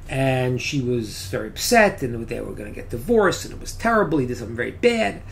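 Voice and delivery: usual speaking voice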